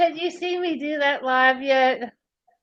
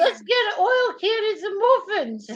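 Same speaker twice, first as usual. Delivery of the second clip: high, hammed-up Monty Python Aussie accent; bad Aussie-British accent; 7 semitones higher than usual. Joking about muffins on stream, bad Aussie-British accent. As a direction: holler it in a terrible mock Australian accent. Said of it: "worst British slash Australian".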